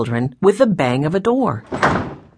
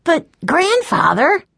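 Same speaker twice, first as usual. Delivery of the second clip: put-on high, slow, drawn-out child's plea; little boy starting to object; protesting young boy; 12 semitones higher, slower than usual. A narrator's reading. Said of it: "began Benny".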